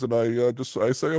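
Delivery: ordinary delivery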